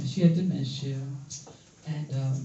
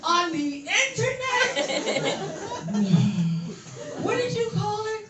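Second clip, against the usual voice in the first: man voice; 4 semitones higher, slower than usual. Acted. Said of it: "this is my man voice".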